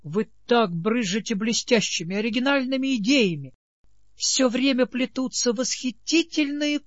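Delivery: high, breathless adoring gush; fervent gushing. A narrator's reading. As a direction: swoon over his villainous ideas. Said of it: "пылко сказала Нинет".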